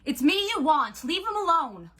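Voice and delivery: high, loud, defiant shout; desperate yell. A narrator's reading. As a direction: yell it to draw the attacker onto yourself. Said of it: "Nico yelled".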